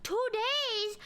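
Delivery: high, indignant wail of disbelief; tearful outburst. Retold or imitated. Stranded passenger to airline agent, tearful outburst. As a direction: cry out in outraged panic, pitch climbing. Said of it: "I was crying".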